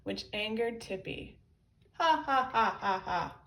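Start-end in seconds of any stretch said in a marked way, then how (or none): 2.0-3.4 acted mocking "ha ha ha"; put-on storybook laughter; cops laughing. A narrator's reading. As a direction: laugh it out in a falling run. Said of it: "started to laugh".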